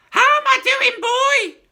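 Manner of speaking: high, loud, slow screech; screechy old grandma crowing. Acted. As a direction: screech it down from the attic. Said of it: "she shouted".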